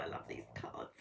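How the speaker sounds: breathy whispered delight. Real, not acted. Reader whispering to herself over her cards. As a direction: whisper it to yourself, thrilled.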